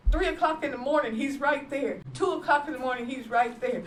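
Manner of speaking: call-and-response testimony; preaching. Real, not acted. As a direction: lift the congregation with each line. Testifying about God's presence.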